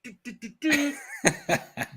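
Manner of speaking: a quick high breathy laugh. Real, not acted. Host laughing at the chat mix-up, high laugh. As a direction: laugh it off lightly. Laughs at "Chinese bot" remark.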